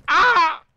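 sharp loud startled yelp, high; a sudden shriek of fright; startled scream. Real, not acted. Sudden startle mid-game. (grito de susto agudo y fuerte)

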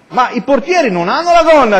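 high-pitched, incredulous protest; children's objection. Acted. Children challenging the princess about the skirt, high-pitched objection.

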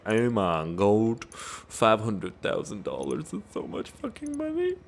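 slow, drawn-out put-on gasp of disbelief; hammed-up, slow overwhelmed voice; mock astonishment. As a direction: exaggerate being floored, dragging the words. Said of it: "now I'm like".

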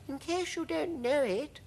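very high, gentle ladybird voice; sweet high-pitched creature; kind ladybird. A narrator's reading. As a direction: explain kindly in a tiny high voice. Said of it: "obviously a kind and gentle creature".

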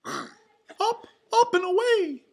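high, loud cartoon mouse cheer; Mickey. A narrator's reading. Mouse rallying friends for a balloon ride, excited cheer. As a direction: cheer it in a squeaky falsetto. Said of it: "Mickey cheered".

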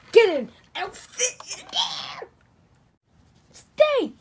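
high, loud, straining commands; forceful shouted order. Pretend. Toy cop crams prisoners into car, straining high shouts. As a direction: shout it while shoving someone in.

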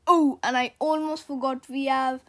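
plain reading voice

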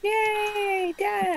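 high, soft little cheer; half-hearted high-pitched hooray; mock cheer. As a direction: cheer weakly in a high small voice.

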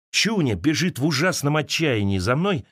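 normal talking voice

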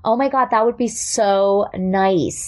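slow, sweet California accent; mild California people-pleaser accent; pleasing California voice. Shown as a demonstration. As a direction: gush in a California accent to keep the peace. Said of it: "a little bit more of a California, like, pleasing".

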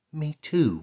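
ordinary delivery

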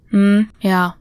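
ordinary delivery

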